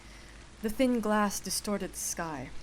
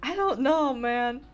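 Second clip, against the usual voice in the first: incredulous; 7 semitones higher, 4 dB louder than usual. Real, not acted. Reacting to the odd dialogue.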